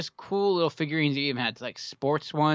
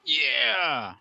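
toy commercial yeah; 7 semitones higher than usual. Shown as a demonstration. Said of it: "Now you got it".

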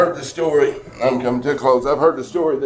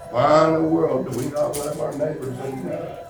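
normal talking voice

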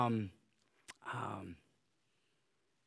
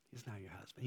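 "He's now your husband" repeated much softer, dropping to near hush; a hushed, intimate repeat; soft echo; 7 semitones lower, 17 dB quieter, faster than usual. Acted. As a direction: let the words fall away tenderly. Softer echo of previous line.

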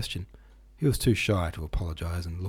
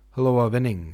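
loud, higher greeting across the playground; hearty shout; 4 semitones higher, 7 dB louder than usual. Acted. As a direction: call out heartily to the small boy. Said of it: "shouted Mister Watson".

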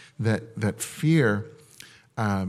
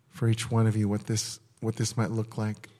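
ordinary delivery

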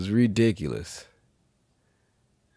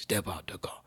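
whispered cop order; 9 dB quieter than usual. Retold or imitated. Voicing the cop.

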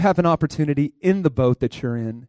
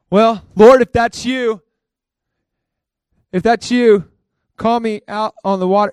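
Peter calling out; 5 semitones higher, 6 dB louder than usual. Retold or imitated. Peter calls to Jesus.

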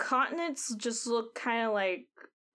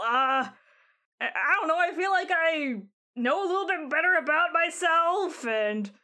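higher, louder, protesting character voice; defensive Squall; 5 semitones higher, 4 dB louder than usual. Acted. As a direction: push back like an accused kid. Player voices Squall defending self.

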